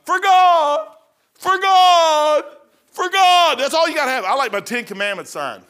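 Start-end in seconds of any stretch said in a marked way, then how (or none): 0.1-0.9 high, loud, drawn-out cry; loud preaching. Shown as a demonstration. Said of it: "All you have to do is know three or four verses". 1.4-3.6 high, loud, insistent repeats; repeated proclamation. Shown as a demonstration. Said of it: "All you have to do is know three or four verses".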